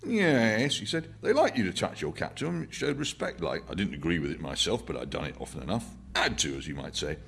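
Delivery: put-on old working-class Londoner, reminiscing; old man's voice. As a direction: play the old man, unhurried and rambling. Old man voiced in novel reading.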